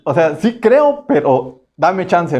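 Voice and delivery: mock hesitant "sí creo, pero dame chance"; drawn-out reluctant yes. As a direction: stretch it like a reluctant child. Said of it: "la respuesta".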